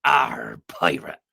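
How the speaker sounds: low, growled pirate accent. Shown as a demonstration. Host tells [name] to practise an accent, pirate accent. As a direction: put on a West Country pirate accent. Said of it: "practice your best".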